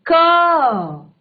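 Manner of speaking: क drawn out to show the "a" at its end; stretched final a. Shown as a demonstration. Teacher stretches क to show the vowel. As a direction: hold the a sound in क. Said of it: "तो अंत में".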